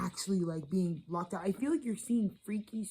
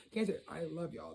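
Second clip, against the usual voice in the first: normal talking voice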